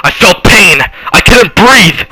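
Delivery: loud, high-pitched panicked account; frantic storytelling. Acted. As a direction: pour out the horror at the top of your voice. Frantic telling of violent attack.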